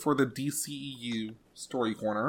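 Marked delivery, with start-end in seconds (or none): 0.2-1.3 "D C E U" run together so it sounds like "ew"; DCEU sounding like ew. Real, not acted. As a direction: say "D C E U" so its end sounds like "ew". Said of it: "It sounds like you're saying ew".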